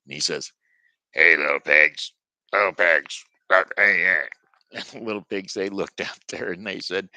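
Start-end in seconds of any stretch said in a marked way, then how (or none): 1.1-4.3 loud, lower wolf voice calling at the door; big bad wolf. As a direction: boom it out like a hungry wolf. Wolf demands at brick house.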